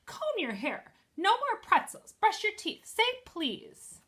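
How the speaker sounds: high, loud bossy big-sister orders; older sister bossing her little sister around; bossy grown-up voice. A narrator's reading. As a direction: bark orders like a pretend mom. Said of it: "in a grown-up voice".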